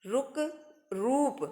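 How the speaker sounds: रुक clipped short, रूप with a long ऊ; short then long u. Shown as a demonstration. Teacher repeating the pair for children. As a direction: contrast रुक and रूप vowel length. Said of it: "तो ये है छोटे ऊ का रुक".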